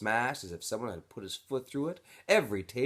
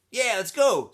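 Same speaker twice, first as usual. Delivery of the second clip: much higher, louder call across the room; 14 semitones higher, 11 dB louder than usual. Acted. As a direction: call him over to dinner. Armpit calling Stanley.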